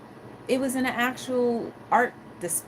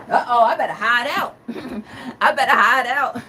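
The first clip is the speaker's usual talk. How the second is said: louder, laughing through it; 6 dB louder than usual. Real, not acted. Joking she must hide from Jersey City viewers, laughing. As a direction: giggle while you say it.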